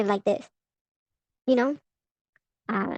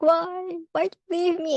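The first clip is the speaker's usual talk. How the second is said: higher, whiny mock sob; mock crying plea; 5 semitones higher than usual. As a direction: pretend to wail at the ex who left. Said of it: "I'll be crying about him later".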